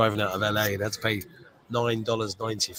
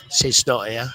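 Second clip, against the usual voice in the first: Sid Snot; 3 semitones higher, 7 dB louder, slower than usual. Acted. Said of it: "Kenny Everett".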